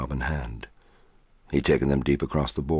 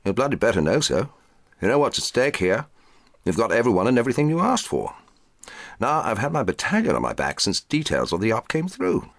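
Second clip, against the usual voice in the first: raised, fast, higher-pitched rant; the major lays into his captain, pressing hard; exasperated major; 8 semitones higher, 3 dB louder than usual. Acted. Major berates captain over the operation, in a fast heated rant. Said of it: "the major exclaimed".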